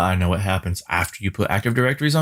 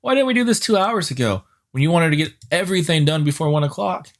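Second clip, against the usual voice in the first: mocking whine; 6 semitones higher than usual. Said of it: "Now it's like".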